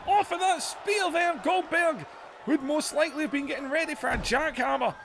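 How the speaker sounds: rising ringside excitement; excited play-by-play. Acted. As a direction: call the big moment like a ringside commentator.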